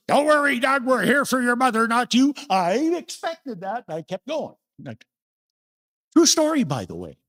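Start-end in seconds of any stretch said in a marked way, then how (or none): 0.1-2.3 high, loud cutting jab; snide ex. Retold or imitated. Ex-wife snubbing Doug at his mother's funeral, high and loud. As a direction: deliver the dig with mock sweetness. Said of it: "and one of them said".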